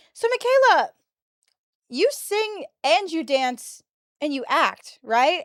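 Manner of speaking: high, slow, put-on friend voice; imitating a friend. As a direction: mimic the friend in a high, slow, sing-song voice. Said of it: "her friend turns to me and goes".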